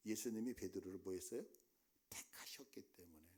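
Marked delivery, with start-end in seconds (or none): 2.1-3.3 whisper. Real, not acted. Key point of the sermon.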